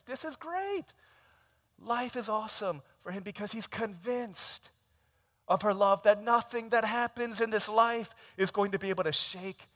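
usual speaking voice

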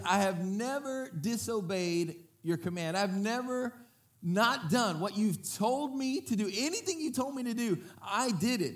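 put-on whiny older brother. Older brother protests to father.

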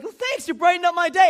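high, loud imitation of a woman; grateful caller. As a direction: gush thanks down the phone.

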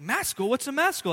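mock confusion over an odd word; friend's baffled question; puzzled question. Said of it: "He's like".

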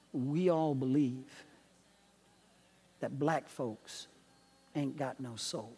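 low, slow, soft North Carolina drawl, lightly done. Retold or imitated. Churchgoer warning the young pastor, low North Carolina drawl. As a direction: lean in and drawl it low like a Southern man. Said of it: "in his North Carolina drawl".

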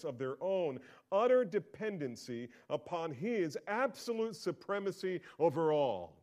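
loud, high, slow impassioned preaching; the preacher pounding home God's supremacy, loud and slow; preaching at full force. Acted. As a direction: build to a pulpit climax.

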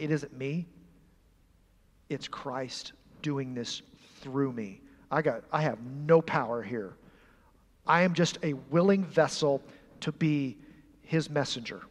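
hushed humble confession. Acted. Peter telling the rulers it is not him, soft and humble. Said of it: "Peter is telling him emphatically".

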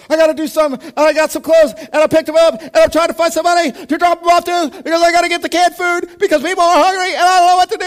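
hammed-up panic, very high and loud; frantic freak-out. Panicked callers phoning the church, hammed-up frantic. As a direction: imitate callers shouting in a breathless high-pitched frenzy. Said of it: "freaking out frantic".

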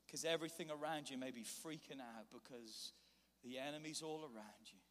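ordinary talk, nothing marked

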